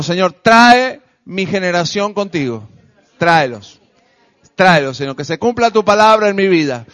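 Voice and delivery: loud, forceful pleading to God; emphatic petition in separate, punched phrases; leading congregational prayer. Real, not acted. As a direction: call out the prayer for others to repeat. Leads congregation in spoken prayer.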